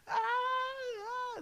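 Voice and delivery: high wailing cries, mimicked; imitated panicked cries. Mimicking his cousins crying out in panic, high wail. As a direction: wail high like a scared kid. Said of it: "everybody in the house yelling".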